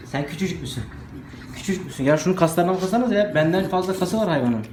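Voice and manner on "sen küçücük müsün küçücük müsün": softer cooing question. Real, not acted. Owner coos softly to the tiny puppy. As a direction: coo it gently, almost to herself.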